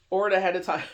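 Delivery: ordinary delivery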